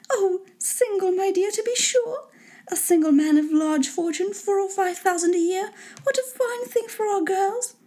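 high-pitched scheming mother, thrilled; put-on high lady voice; delighted wife. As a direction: pitch it way up, relishing the good news. Wife delighted by rich bachelor.

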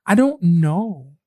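slow, drawn-out "I don't know"; imitating herself. Retelling her reply to the lady, slow and blank. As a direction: draw it out, clueless. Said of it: "I was like".

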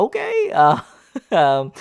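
high giggly okay with a laugh. Real, not acted. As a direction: laugh it off, embarrassed. Laughs off relationship remark.

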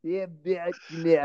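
hammed-up bad Russian accent; terrible Russian-accent impression; Teddy KGB. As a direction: quote it in a thick mock Russian accent. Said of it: "That is a terrible Russian accent he has".

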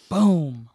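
a loud mock crash sound; loud boom. Telling friends how the brick hit, a loud boom. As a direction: punch out the boom like an impact.

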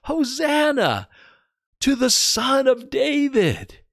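put-on high, slow, childlike cry of praise; children crying out. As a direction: call it out like a child in the temple. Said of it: "children crying out".

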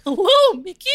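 very high, loud squeaky mouse greeting; Minnie Mouse. Retold or imitated. Suggesting a harder impression, squeaky mouse greeting. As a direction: squeak like a cartoon girl mouse. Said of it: "like Minnie Mouse".

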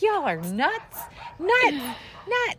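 laughing. Retold or imitated. Laughing retort.

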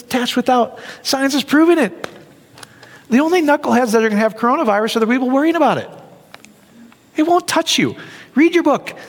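usual speaking voice

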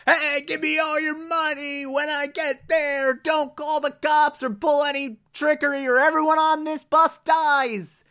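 high put-on hijacker voice demanding money; bus jacker threat. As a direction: play a menacing hijacker from up high, slowing on the last words. Bus jacker makes his demands.

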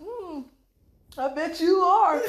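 high, slow sly "I bet you are"; knowing tease. Retold or imitated. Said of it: "They be like".

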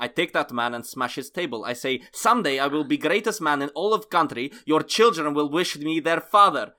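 mock-angry Russian vow; angry Russian accent. Nikolai threatening the interviewer, mock-angry Russian accent. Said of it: "my anger is best of me".

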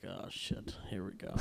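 hushed aside under the breath; whispered oh no. Retold or imitated. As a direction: whisper it like a private worry. Inner panic, aside to himself.

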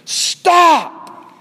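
high, loud single stop; God shouting down at rebellious creation; shout of justice. Pretend. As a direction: thunder it down as a judge. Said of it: "letting out a shout of justice".

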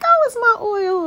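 high, loud squeal of delight; giddy excited squeal; all geeked up. Retold or imitated. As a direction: squeal it like a thrilled kid opening a gift. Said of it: "I'm, like, all geek 'cause I'm like".

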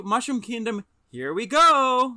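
the hero's eager battle cry, drawn out; Mario catchphrase. Retold or imitated. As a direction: cheer "Mushroom Kingdom, here we go" in a lively Mario voice. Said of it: "one of Mario's taglines is".

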